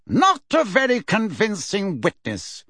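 high, dismissive and superior; lofty. Acted. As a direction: look down on her. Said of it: "said Fudge loftily".

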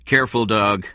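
sudden note of threat; sudden warning. A narrator's reading. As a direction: cut in with a warning. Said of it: "Benjamin said suddenly".

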